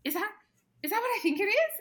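high, loud, rising disbelief; a gasp of hope turned into a question; amazed excited husband. Retold or imitated. Husband seeing the faint line, amazed and excited.

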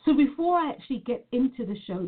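normal talking voice

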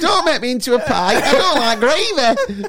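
lightly voiced pleading chicken; a hint of a squeaky, laughing chicken. Voicing the escaping chicken.

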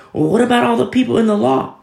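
high, loud mock objection; mock questioner. Preacher voicing a skeptic in the church, mock objection. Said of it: "you say, like".

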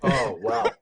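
ordinary delivery

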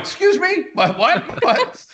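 high-pitched, incredulous double take; retold disbelief. Investor floored by the doctor's market figure, high comic disbelief. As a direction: squeak out your astonishment, playing it for laughs.

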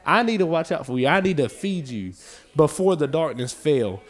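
loud, driving preacher's cadence; preaching. Acted. Preacher urging the church with a loud driving voice. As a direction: build the sermon hard and loud.

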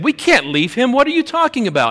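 high-pitched, mock-indignant disbelief; alarmed protest. Kim to Angie about leaving him, alarmed protest. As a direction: imitate a panicked objection, voice climbing sharply. Retold argument inside the car.

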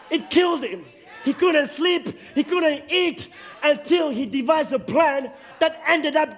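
loud, driving pulpit delivery; fired-up preacher pressing the congregation to reject the label; preaching at full force. Acted. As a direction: preach it out, hammering each line.

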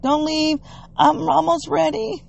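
high, sing-out mimicked plea; put-on high voice, stalling for time; imitated plea. Imitates person's habitual plea to wait.

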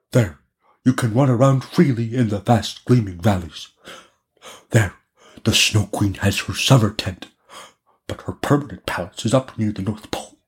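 lightly voiced reindeer, dwelling on each place; a hint of an animal character, unhurried. Reindeer describes the Snow Queen's lands, lightly voiced.

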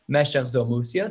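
ordinary talk, nothing marked

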